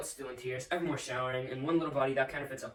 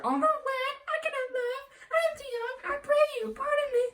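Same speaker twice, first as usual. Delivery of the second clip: mocking his daughter in a much higher, whining falsetto; mock whining girl. Father mocks his daughter's refusals in a whining falsetto. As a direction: whine it back at her like a spoilt child. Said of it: "a whining mammet in her fortune's tender".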